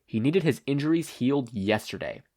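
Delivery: plain reading voice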